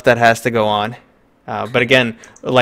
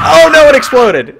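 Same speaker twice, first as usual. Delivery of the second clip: high, loud yelp of real surprise; startled shout; 11 dB louder than usual. Real, not acted. Streamer seeing his game test explode, startled loud shout. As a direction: caught off guard as it blows up.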